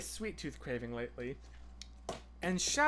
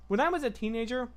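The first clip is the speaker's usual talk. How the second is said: talking around a bite of food; mouth full; 6 semitones higher, 4 dB louder, faster than usual. Real, not acted. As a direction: speak through a mouthful. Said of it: "talking with my mouth full".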